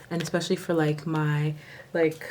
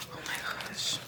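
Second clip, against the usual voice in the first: whisper; 10 dB quieter, faster than usual. Real, not acted. Awed by foils.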